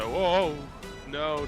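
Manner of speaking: ordinary talk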